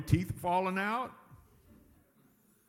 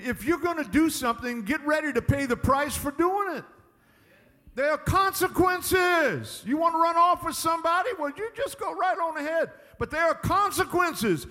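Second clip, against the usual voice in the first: high, loud, hammering preacher climax; preaching at full force; 9 semitones higher, 6 dB louder than usual. Acted. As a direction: drive the warning home from the pulpit.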